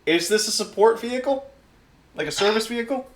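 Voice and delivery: high-pitched, eager bureaucrat; high voice of an official with a clipboard; pencil-pushing clipboard official. Acted. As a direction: pipe up like a polite pencil-pusher. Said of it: "pencil-pushing motherfucker with a clipboard".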